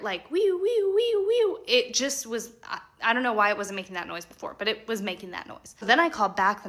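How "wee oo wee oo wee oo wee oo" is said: very high vocal siren, wee-oo on repeat; alarm imitation. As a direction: mimic a wailing house alarm up high. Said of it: "that is when I can hear it".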